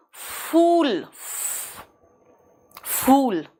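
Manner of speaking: "फूल" as "fool", f held long; English f. Shown as a demonstration. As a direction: stretch the airy f of "फूल". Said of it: "और ये फूल मूर्ख".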